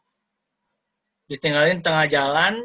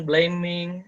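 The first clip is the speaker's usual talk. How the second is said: ordinary talk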